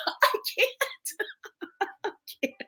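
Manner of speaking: wheezing laugh, nearly silent; breathless laughing. Real, not acted. Laughing at the take a nap advice, wheezing. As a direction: laugh until no voice is left.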